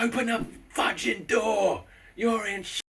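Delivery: hammed-up angry shout at the neighbour; annoyed yelling. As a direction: yell it through the door, fed up. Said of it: "I was starting to get really annoyed".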